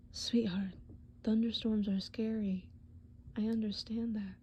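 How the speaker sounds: gentle, hushed comfort with long pauses; tender, reassuring boyfriend. Acted. Boyfriend to girlfriend scared of thunderstorms, tender reassurance. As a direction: soothe her softly, earnest and unhurried.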